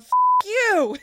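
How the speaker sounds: sudden loud, high mock yell; yelled F you. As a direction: blurt it out as a frustrated shout. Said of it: "that I yelled".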